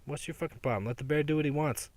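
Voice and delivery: ordinary talk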